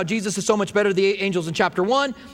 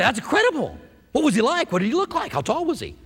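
high-pitched, wide-eyed amazement; excited questioning; 6 semitones higher than usual. Acted. Believer hearing of a friend's angel visit, excited questioning. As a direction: fire off eager, amazed questions.

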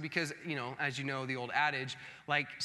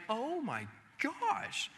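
amazed; 5 semitones higher, 3 dB quieter, much slower than usual. Retold or imitated. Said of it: "genuinely impressed".

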